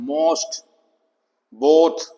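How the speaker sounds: most, both with a long rounded O; long O sound. Shown as a demonstration. Teacher giving common-word examples, long O. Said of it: "long ओ की sound आएगी".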